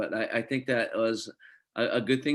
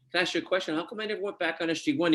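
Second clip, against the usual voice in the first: higher-pitched retold question to a director; puzzled question; 7 semitones higher, faster than usual. Actor asking his pilot director, puzzled and higher-pitched. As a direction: retell yourself asking, pitched well up.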